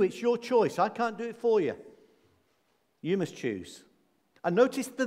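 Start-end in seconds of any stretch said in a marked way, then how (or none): none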